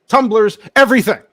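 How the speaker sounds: big, high-pitched sales flourish; excited merch hype. Real, not acted. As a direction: belt out the list like a pitchman. Hyping the merch range on offer.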